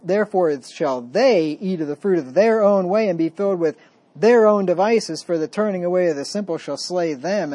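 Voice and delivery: loud, weighty scripture reading; booming reading of the text. Acted. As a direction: proclaim the verse with force. Reads Proverbs passage aloud.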